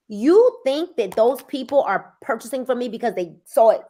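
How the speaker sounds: ordinary delivery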